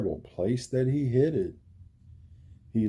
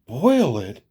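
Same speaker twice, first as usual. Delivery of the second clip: gasped; 3 semitones higher, 7 dB louder than usual. A narrator's reading. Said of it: "gasped Horton".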